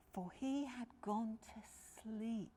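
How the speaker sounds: plain reading voice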